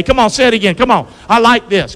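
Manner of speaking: usual speaking voice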